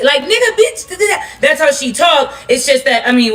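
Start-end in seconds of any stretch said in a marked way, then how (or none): 0.2-1.2 lightly mocked ex-employer's crude talk; imitating her speech. Quoting the boss to viewers in a mock voice. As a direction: toss off her crude words in a higher voice. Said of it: "She talked like".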